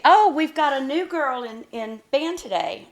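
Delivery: hammed-up teacher addressing the whole band; band director announcing. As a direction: imitate a band director calling out to everyone. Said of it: "the band director".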